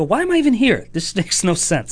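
high, exasperated complaint; baffled. Retold or imitated. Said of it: "And I go".

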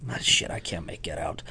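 soft whispered self-correction; catching his own mistake in a whisper; whispered aside. Real, not acted. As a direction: drop to a whisper for yourself. Aside to himself.

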